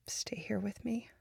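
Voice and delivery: soft, high whisper; tender whispered plea; pleading whisper. Acted. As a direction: ask them to stay in a hush. Asking partner to stay.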